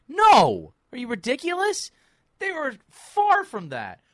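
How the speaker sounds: loud and high, flatly rejecting the idea; exasperated outburst. Real, not acted. Podcast host scoffing at Embiid-Harden hype, loud outraged shout. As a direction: shout it down like it's absurd.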